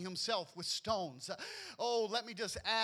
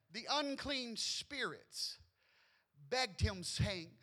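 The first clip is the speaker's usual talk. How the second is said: usual speaking voice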